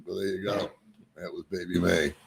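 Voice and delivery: ordinary talk, nothing marked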